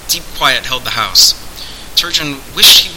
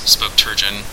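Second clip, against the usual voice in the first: plain reading voice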